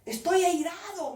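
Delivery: loud, high imitation of divine wrath; imitating God's strong voice. God answers her question by repeating, loud imitated anger. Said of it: "me volvió a repetir".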